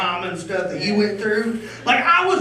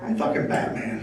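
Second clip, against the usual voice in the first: low, soft gravel whisper; hushed mock Batman voice; Batman growl; 10 semitones lower, 7 dB quieter than usual. Inner thought, gruff Batman line.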